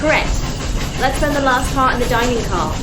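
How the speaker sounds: shouting over noise. Acted. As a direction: shout the plan over the engine. Shouting in the hot engine room.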